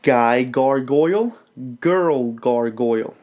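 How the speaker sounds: put-on "Guy gargoyle, girl gargoyle" drawn out slowly; over-articulate "gargoyle"; teacher modelling the twister; slow careful diction. Shown as a demonstration. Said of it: "Gargoyle is one of the hardest words".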